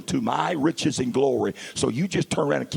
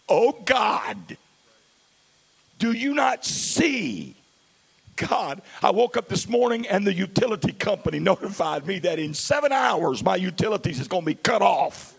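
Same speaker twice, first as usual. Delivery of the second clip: panicked prayer; 8 semitones higher than usual. Retold or imitated. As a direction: cry out in panic, pleading. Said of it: "We hit the panic button".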